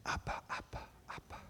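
soft whispered comfort; soothing whisper. Retold or imitated. Father comforting his frightened son in a whisper. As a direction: hush the child gently. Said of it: "And he said".

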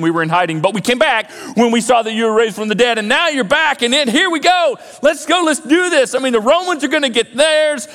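rally your leader, raring to go; eager urging. Acted. Followers eagerly urging Jesus to fight.

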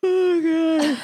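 huge, loud mock yawn through the words. Casting director yawning through an actor's monologue, mock yawning. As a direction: imitate a bored director's high, drawn-out yawn. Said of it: "a casting director who yawned".

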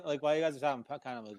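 ordinary delivery

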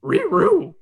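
sighing cartoon dog; Scooby-Doo voice. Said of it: "Scooby agrees with a sigh".

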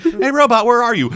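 high, loud call for a friend. Retold or imitated. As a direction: call out like a boy looking around. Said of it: "Will's like".

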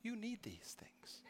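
a soft, half-laughing whisper; loving whisper. Retold or imitated. Wife gently telling him he needs the gym, whispered with a laugh. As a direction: hush it gently like a wife teasing. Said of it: "lovingly say".

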